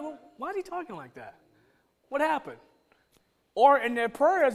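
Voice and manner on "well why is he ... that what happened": hushed, higher-pitched bewildered aside; sincere puzzlement. Retold or imitated. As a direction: ask it softly, honestly baffled. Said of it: "I wasn't trying to be funny".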